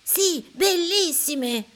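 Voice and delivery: high, slow, sure reply; answering child. A narrator's reading. Boy answering the clouds, high and slow. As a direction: reassure the clouds. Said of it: "risponde Gianni".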